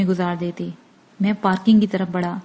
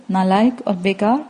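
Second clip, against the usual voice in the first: plain reading voice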